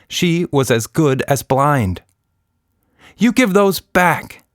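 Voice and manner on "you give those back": high, loud and slow demand; a girl yelling at a bully; angry child's shouted order. Acted. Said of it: "shouted Nina".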